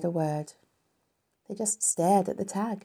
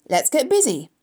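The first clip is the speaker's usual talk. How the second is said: high, loud call to get moving; raised, higher character voice giving commands; bossy order; 8 semitones higher, 6 dB louder than usual. A narrator's reading. Character briskly gives orders.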